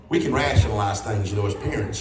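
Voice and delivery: deep, calm parental rationalizing; mother calmly explaining herself to her boy; mama's low voice. Retold or imitated. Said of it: "She goes".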